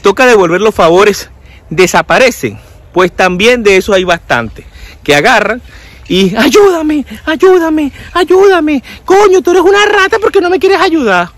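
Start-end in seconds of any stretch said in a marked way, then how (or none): 6.4-11.3 mock high-pitched pleading; pedigüeño insistente. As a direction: beg insistently in a put-on high voice. Imita al pedigüeño que insiste. (pedigüeño insistente con voz aguda fingida)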